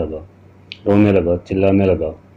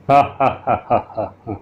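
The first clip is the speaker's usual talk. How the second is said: drawn-out eerie laugh, slightly higher; scary cackle; 3 semitones higher than usual. Acted. Ghost laughing at the frightened man, eerie cackle. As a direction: let out a creepy ghostly cackle. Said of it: "एक डरावना अट्टहास हुआ".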